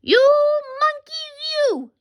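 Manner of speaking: high-pitched mock scolding; scolding peddler. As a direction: scold the naughty monkeys in a high voice. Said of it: "this made the peddler angry".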